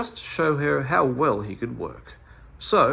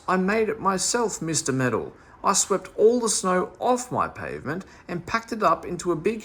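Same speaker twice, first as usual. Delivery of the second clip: horrid baker; 6 semitones higher than usual. Acted. The baker Mister Biscuit tells Meddle off, high and nasty. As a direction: scold the meddler for undoing his work. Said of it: "in a horrid sort of voice".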